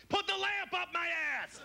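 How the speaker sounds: high-pitched drawn-out bedroom scream; screamer. Acted. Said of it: "some people be screamers and yellers".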